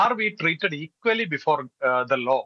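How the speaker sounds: are we treated equally before the law drawn out very slowly; very slow question. Real, not acted. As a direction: drag the question out, word by word. Rhetorical English question. (വളരെ പതുക്കെ, ഓരോ വാക്കും നീട്ടി ചോദിക്കുക)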